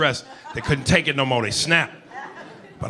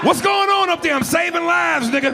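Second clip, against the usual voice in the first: very high, loud holler; mock frantic cabin shout; shouting on a plane; 14 semitones higher, 4 dB louder than usual. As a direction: yell back high and strained.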